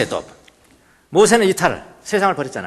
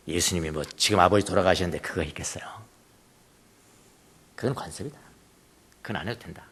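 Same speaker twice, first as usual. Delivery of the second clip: whispered aside; 11 semitones lower, 7 dB quieter than usual. Real, not acted. Preacher's confiding aside.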